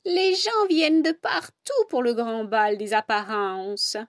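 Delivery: a hint of a drowsy old she-cat; lightly voiced old tabby. Old cat Pelote speaks.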